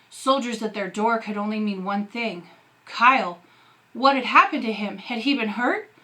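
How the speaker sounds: usual speaking voice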